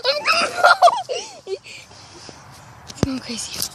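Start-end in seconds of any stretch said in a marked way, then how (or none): 0.1-1.6 slow, high, laughing through words; stretched-out giggly retreat. Real, not acted. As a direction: laugh as you give up. Laughing, dog chases her.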